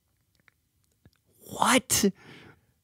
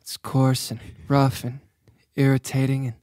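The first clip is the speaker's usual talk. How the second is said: lower, softer sulky complaint; a hint of brooding Anakin, lower and softer; Anakin Skywalker; 8 semitones lower, 5 dB quieter than usual. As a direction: grumble it like a sulky young Jedi. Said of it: "I like your Anakin".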